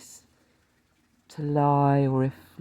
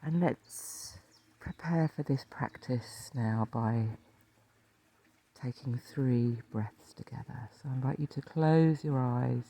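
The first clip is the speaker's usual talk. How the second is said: calm meditation teacher, leaving space to breathe; guided meditation. Acted. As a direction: lead listeners gently into rest, phrase by phrase.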